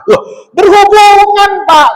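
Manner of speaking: a high, loud, slowed "berhubungan"; insistent shout. Retold or imitated. Caretaker firmly insisting to the visiting cleric, high and loud. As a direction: insist hard that it is connected.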